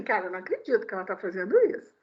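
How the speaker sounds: fast, low muttered disbelief; mock astonished aside. Preacher voicing imagined onlookers on the metro, fast and low.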